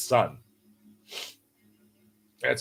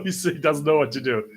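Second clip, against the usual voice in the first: plain reading voice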